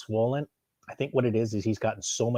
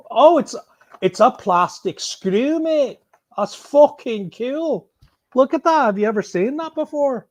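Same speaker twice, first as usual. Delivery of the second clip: much higher and louder, gushing over a gadget; delighted about a plastic screw, excited and loud; thrilled; 11 semitones higher, 5 dB louder than usual. Real, not acted.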